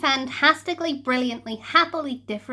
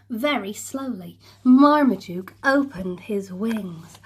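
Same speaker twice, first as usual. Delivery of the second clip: usual speaking voice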